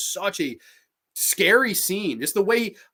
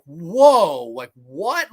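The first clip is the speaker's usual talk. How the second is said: retold shock; 6 dB louder, much slower than usual. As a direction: act out being floored by a jump scare. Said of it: "I was like".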